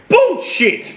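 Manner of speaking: high, indignant rebuttal; outraged. Real, not acted. Reading a viewer's insult to his favourite film, outraged. Rejects a comment about the film.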